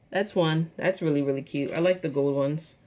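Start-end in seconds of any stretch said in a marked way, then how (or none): none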